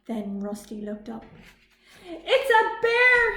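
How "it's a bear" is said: very high, loud and slow; robot screaming at the sight of a bear; terrified scream. A narrator's reading. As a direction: scream in terror. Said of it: "he screamed".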